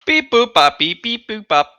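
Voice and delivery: chirpy beep-boop robot. Pretend. Ship AI robot noises.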